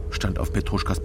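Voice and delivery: ordinary talk, nothing marked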